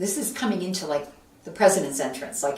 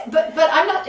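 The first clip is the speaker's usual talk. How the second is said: high, louder, stammering through a laugh; flustered laughing protest; 10 semitones higher, 9 dB louder than usual. Retold or imitated. Left at the gate, laughing protest to the staffer. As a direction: protest helplessly as she leaves.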